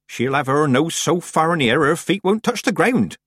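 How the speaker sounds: high-pitched country-woman chatter; animated village granny, pitched high; hearty old witch. A narrator's reading. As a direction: grumble on as a rustic old witch.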